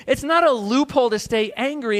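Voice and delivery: ordinary delivery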